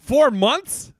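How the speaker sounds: loud high disbelief at four months; stunned disbelief, loud and high; shocked four months. Real, not acted. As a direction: cry out the number in shock. Incredulous repeat.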